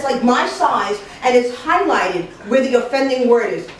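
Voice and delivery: plain reading voice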